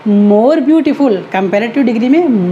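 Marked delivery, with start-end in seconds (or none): 0.1-1.2 more beautiful drawn out very slowly and a bit louder; teacher spelling out the right form; slow model answer. Shown as a demonstration. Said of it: "कैसे लगाएंगे?".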